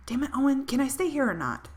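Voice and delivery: exasperated. Acted. Robin loses patience with Owen.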